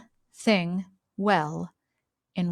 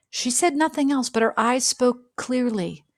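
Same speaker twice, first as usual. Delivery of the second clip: ordinary delivery, nothing marked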